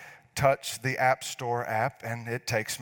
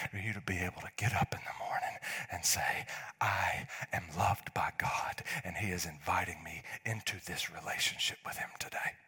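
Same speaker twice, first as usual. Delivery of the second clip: hushed whisper; 4 semitones lower, 5 dB quieter than usual. Real, not acted. Pastor whispering God's love to listeners, a soft whisper. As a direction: whisper it tenderly, like a blessing.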